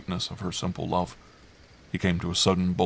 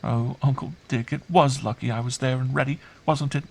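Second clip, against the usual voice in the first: put-on high child voice, glad and relieved; affectionate child; 7 semitones higher than usual. Girl hugging her uncle, fondly relieved. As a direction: say it as a fond little girl.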